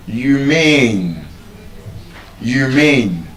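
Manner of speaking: humane with a long, weighted second syllable; stress on second syllable. Shown as a demonstration. Teacher repeating an example word, humane stressed on its second syllable. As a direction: hold the MANE of humane. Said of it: "is stressed on the second syllable".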